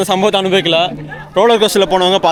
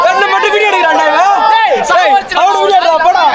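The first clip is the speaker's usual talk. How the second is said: shouting on a ride; 11 semitones higher than usual. Real, not acted. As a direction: yell over the ride's rush.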